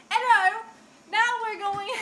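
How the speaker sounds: loud hello in a put-on British accent; amateur British accent, projected; attempted British accent. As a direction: have a playful go at British. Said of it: "trying to do a British accent".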